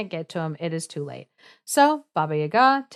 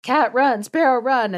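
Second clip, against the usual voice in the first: higher, louder mock shout; retold shout; 5 semitones higher, 5 dB louder than usual. Brave youth calling cat and sparrow to help, retold shout. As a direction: call out urgently, without a full yell. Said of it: "the brave youth shouted".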